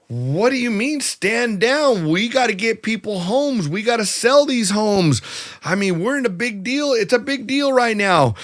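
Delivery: high, incredulous pushback; indignant protest. Retold or imitated. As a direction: protest the order like it's absurd. Acted protest at stand-down order.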